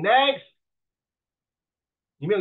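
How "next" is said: loud, high cut-off call; dismissive interviewer. Acted. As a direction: dismiss the candidate sharply. Impatient interviewer cuts candidate off.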